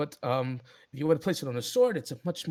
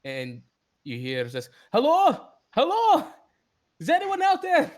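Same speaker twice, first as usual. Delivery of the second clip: high, loud calling out; calling out in character; 10 semitones higher, 5 dB louder than usual. Acted. Sindri calls to the people outside, high loud. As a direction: shout out through the door, fed up.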